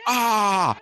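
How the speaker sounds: short high mock laugh burst; imitated laugh. Streamer copies a viewer's laugh, quick high mock yelp. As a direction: copy that listener's laugh in one yelp. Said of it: "That was a sort of like".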